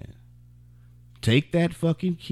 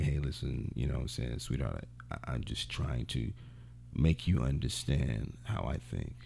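soft, lower, smooth-talking man; smooth sweet talk; 11 semitones lower, 12 dB quieter than usual. Retold or imitated. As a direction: ease it out like a smooth charmer. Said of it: "finesse style and be like".

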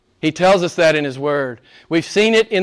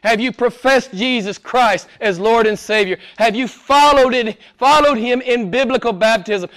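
ordinary talk

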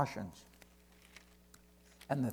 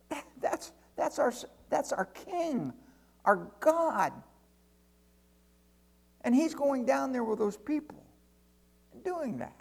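high-pitched, halting protest; dismayed angel; 8 semitones higher than usual. Acted. Angel watching his King go down among people, dismayed. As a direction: sputter in acted disbelief, voice pitched well up. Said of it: "I'd talk like that".

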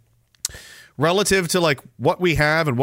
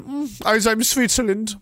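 put-on higher, slow Swiss-accent parody; mock Swiss accent; 6 semitones higher, slower than usual. Host playing carefree Switzerland in the sun, mock Swiss accent. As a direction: drawl it high in a fake Swiss accent. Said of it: "How do you do a Swiss accent?".

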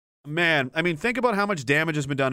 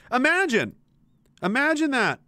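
higher, mock-astonished exclamation; sarcastic surprise; 8 semitones higher than usual. Real, not acted. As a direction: feign shock to mock them.

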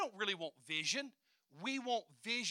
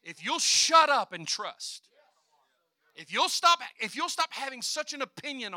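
forceful; 5 semitones higher, 9 dB louder than usual. Shown as a demonstration. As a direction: drive it home louder. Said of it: "I feel to say it in that way".

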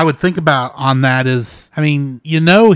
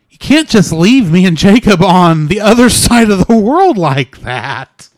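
exasperated outburst; 4 semitones higher, 6 dB louder than usual. Real, not acted. Scolding AI for abandoning them.

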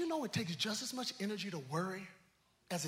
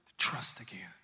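ordinary talk

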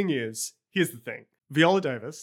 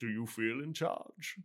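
low Bane voice; 5 semitones lower, 9 dB quieter than usual. Retold or imitated. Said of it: "before Bane says".

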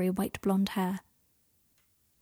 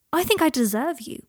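higher, louder, emphatic plea; impassioned declaration; 7 semitones higher, 5 dB louder than usual. Acted. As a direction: declare it with raised voice and conviction.

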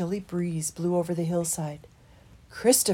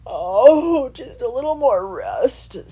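sighing; 6 semitones higher, 8 dB louder, slower than usual. A narrator's reading. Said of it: "sighed Lounger".

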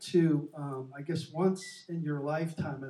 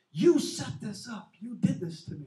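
retold angry accusation; 6 semitones higher, 5 dB louder than usual. Father accusing his children of a setup, retold angry shout. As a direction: act out the father's outburst. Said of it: "he just explodes".

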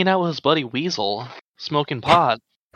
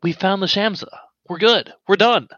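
higher, pressing to leave now; insistent, rising pitch urging; urgent pleading; 7 semitones higher than usual. Acted. Player urging captain to leave.

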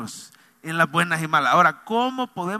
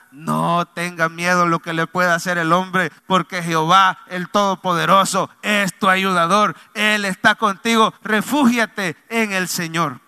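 louder fervent preaching; 5 dB louder than usual. Acted. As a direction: proclaim it as a promise. Preacher assuring of God's help.

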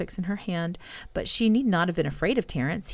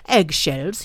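loud, astonished outcry; crying out; 5 dB louder than usual. A narrator's reading. Terence springing to his feet at the answer, crying out. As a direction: cry it out in disbelief. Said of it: "he cried".

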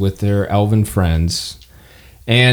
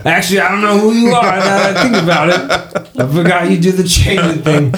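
high cat voice with giggles; laughing in character; 8 semitones higher than usual. Acted. Marvin voice breaking into laughter.

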